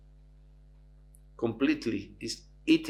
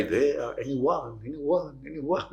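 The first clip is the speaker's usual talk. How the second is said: softer echo repeating; 3 semitones higher, 4 dB quieter, slower than usual. Retold or imitated. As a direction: let it fade like an echo. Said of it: "And the echo say". (softer echo repeating)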